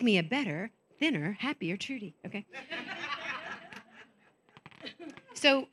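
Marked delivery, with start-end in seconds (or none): 2.5-3.8 a quiet laugh that rises at the end; gentle laugh after a joke; soft trailing laugh. Real, not acted. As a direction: let a soft laugh slip out.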